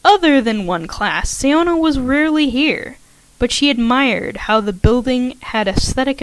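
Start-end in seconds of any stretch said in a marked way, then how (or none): none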